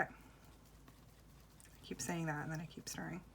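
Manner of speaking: whispering. Real, not acted. Whispering while stirring.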